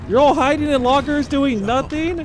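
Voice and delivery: normal talking voice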